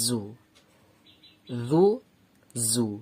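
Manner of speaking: জু said without the tongue out, a buzzing z; za sound demo. Shown as a demonstration. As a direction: repeat জু with the tongue held in. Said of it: "এই সাউন্ডটা বেশি আসছে". (জিভ বার না করে জু)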